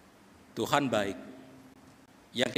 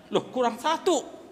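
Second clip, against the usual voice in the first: higher, drawn-out alarm; sudden panic; 9 semitones higher, slower than usual. Acted. Father counting his children finds one missing, panicked. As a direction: realise with a jolt someone is missing. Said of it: "karena udah panik".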